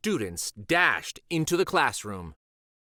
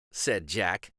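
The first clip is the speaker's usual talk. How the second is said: usual speaking voice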